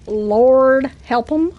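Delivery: slow, stretched-out repeat; drawn-out exclamation. Real, not acted. Streamer on fans of their accent, drawn-out exclamation. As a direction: draw "Lord" out long, letting "'em" lift at the end.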